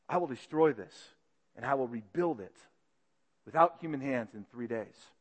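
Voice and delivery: low, hushed accusing witnesses. Acted. Two false witnesses to the council, hushed and low. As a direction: lean in and tell it low, like a secret.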